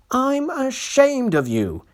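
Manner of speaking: put-on high, slow old-engine scolding; old engine scolding naughty coaches. A narrator's reading. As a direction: scold like a stern elder. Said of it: "he scolded".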